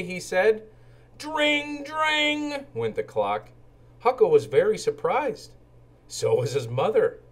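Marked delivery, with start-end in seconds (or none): 1.2-3.5 high ringing dring dring; bell ring. Acted. Clock rings like bike bell.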